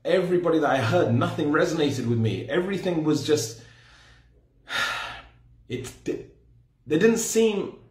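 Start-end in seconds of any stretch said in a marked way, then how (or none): 4.7-5.2 voiceless sigh, lost for words; unimpressed sigh. Retold or imitated. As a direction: sigh instead of finishing the thought. Lost for words, nothing resonated.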